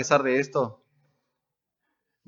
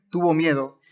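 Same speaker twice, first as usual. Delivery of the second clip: usual speaking voice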